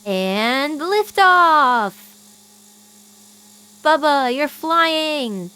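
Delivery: loud, slow, drawn-out launch call; excited cheer. Acted. Friend cheering a toy into the air, loud and gleeful. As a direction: call it like a rocket countdown.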